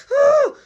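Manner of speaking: high, sudden crying yelp; hammed-up loud howl; loud mock wail. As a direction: yelp like an upset child. Imitates boy crying when pushed.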